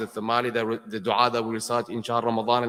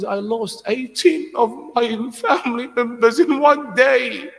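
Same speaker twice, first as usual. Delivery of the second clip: higher, louder, slowing grief-stricken wail; anguished lament; 12 semitones higher, 7 dB louder, slower than usual. Acted. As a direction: cry out the Imam's loss like a breaking mourner. Imam's lament, rising wailing voice.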